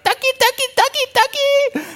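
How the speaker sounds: very high squeaky ducky calls; imitating a lady calling. Pastor imitating a lady at the pasture, squeaky high. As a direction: mimic a clueless woman calling sheep, way up high. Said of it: "one lady goes up, she's like".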